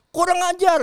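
high, loud mock outburst; angry kiai. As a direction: snap in mock fury.

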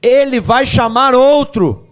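fervent crescendo; building preaching. Real, not acted. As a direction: build it up and hammer the end.

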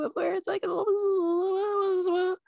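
tiny, very high fairy voice; Tinkerbell voice. Acted. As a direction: squeak it slowly like a little Tinkerbell. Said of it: "the Tinkerbell aspect too".

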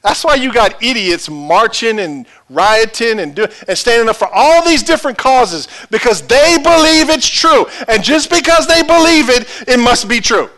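high, forceful sermon delivery; full-force preaching. Real, not acted. Preacher to congregation on people believing causes are true, full-force preaching.

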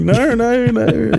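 high-pitched hasty denial; mock protest. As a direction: play innocent when accused. Protesting an accusation of cheating.

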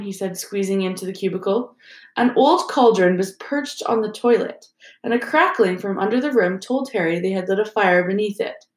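ordinary delivery

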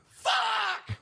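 long, high anguished yell; high-pitched howl of frustration; screamed out. Retold or imitated. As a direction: scream it out in disbelief. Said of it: "right after I scream".

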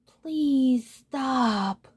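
high, drawn-out plea from the moon; calling plea. A narrator's reading. Squeezed moon to the trampling unicorn, calling out a plea. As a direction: plead with long, stretched vowels. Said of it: "the moon called".

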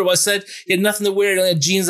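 usual speaking voice

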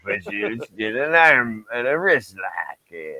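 put-on slow, dragged-out old wrestler's drawl; Stu Hart. Riffing on wrestling holds for a friend, slow drawling impression. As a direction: stretch each word and tail off in a grunt. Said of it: "Fucking Stu Hart".

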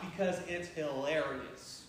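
low, slow amused tease. Real, not acted. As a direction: savour the joke ahead. Teasing the coming passage.

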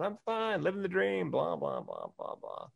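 mock breezy reply that collapses into mumbled blahs; fake cheerful. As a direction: put on a game-face brightness, then let it drop. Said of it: "my game face on".

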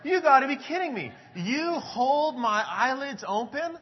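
high, loud incredulous question, "hold" stretched slowly; can't believe what he's reading; astonished disbelief. Retold or imitated. Pastor retelling rereading the verse, loud amazed disbelief. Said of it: "I said".

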